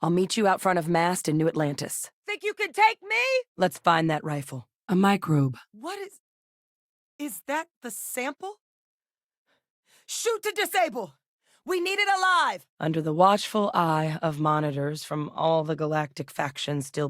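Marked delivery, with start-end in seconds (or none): 2.3-3.1 high-pitched challenging yell; combat taunt. Acted. Fighter to an enemy, taunting yell. 5.7-8.6 soft, very high, halting question; hushed alarm. Acted. Spotting what may be the sample, hushed alarm. 10.1-12.6 high-pitched yell to the squad; urgent combat order. Acted. Ordering allies to take it alive, urgent yell.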